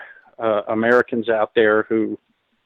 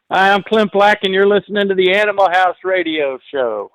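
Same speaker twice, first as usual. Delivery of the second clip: high-pitched, punchy radio liner held up to the end; upbeat station ID; 7 semitones higher than usual. Shown as a demonstration. Guest giving the host one more station ID, upbeat and high. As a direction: announce the show with lifted, energetic pitch. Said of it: "put the emphasis on the right syllable".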